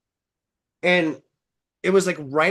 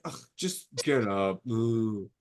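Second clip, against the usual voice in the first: low, softer grumble of an annoyed guy; fed-up dude; 6 semitones lower, 7 dB quieter, slower than usual. Retold or imitated. Dudes on the cliff to the stuck dreamer, imitated grumble. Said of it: "dudes up there, they're like".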